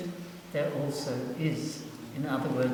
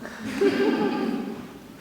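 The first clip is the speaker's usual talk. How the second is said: sudden squeaky burst of laughter; quick high laugh; high real laugh; 5 dB louder than usual. Real, not acted. Laughs at father's question.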